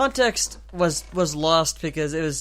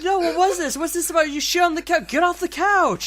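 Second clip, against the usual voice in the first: teasing interrogation; 9 semitones higher than usual. Retold or imitated. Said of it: "And I'm like".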